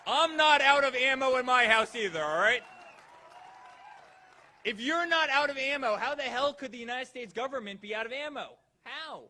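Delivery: loud, far-carrying crowd shout; rally shout. Real, not acted. Speaker firing up the rally crowd, shouting. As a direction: belt it to the back of the hall.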